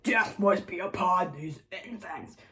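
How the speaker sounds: slow mock-evil voice; drawn-out, put-on villain voice; hammed-up villain decree. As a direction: pronounce doom like a storybook tyrant. Acted decree of villain Amulius.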